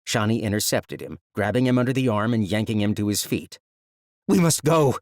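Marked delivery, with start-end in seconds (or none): 4.3-5.0 loud, higher urgent shout. Acted. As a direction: shout it while pulling him. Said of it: "she shouted".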